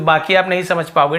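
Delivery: ordinary talk, nothing marked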